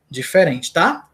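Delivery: ordinary talk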